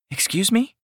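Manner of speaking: high startled squeak. Acted. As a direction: squeak it out in surprise. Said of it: "she squeaks".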